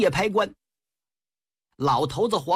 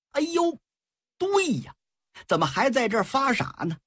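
sudden realization; 7 semitones higher than usual. Acted. Huang Feihu suddenly realizes.

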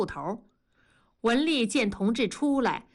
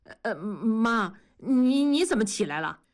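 stammering in fright. A narrator's reading. Son caught by his stern mother on the stairs, stammering scared. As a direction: stumble over the words, caught out. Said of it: "结结巴巴地说".

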